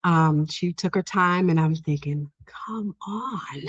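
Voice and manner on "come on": hushed, acted-out "come on" to oneself; impatient inner plea. Worker silently urging a slow patient along, hushed impatience. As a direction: murmur it like an impatient private thought.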